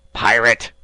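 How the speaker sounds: loud furious shout; enraged yell; angry cry. A narrator's reading. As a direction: hurl the insult at him. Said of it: "cried Captain Speedy".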